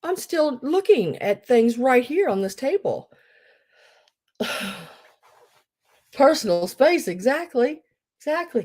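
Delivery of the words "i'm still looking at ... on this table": sarcastic retort. Retold or imitated. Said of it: "I looked at him and said".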